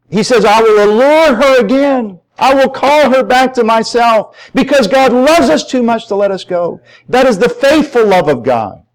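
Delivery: high, driving pulpit voice; impassioned preaching. Real, not acted. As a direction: preach it hard, punching key words. Preaches at full force.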